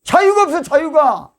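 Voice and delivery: high, loud cry of protest; a sudden impassioned burst; emphatic outcry. Real, not acted. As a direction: shout it out from the pulpit. Preacher exclaiming about no freedom.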